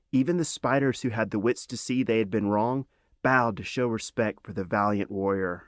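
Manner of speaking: usual speaking voice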